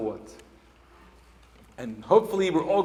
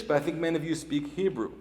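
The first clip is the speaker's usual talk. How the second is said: ordinary talk, nothing marked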